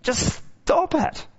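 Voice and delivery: high, slow, drawn-out plea; exasperated mock scold. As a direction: stretch it out in mock despair.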